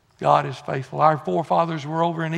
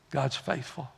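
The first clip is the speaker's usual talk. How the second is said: normal talking voice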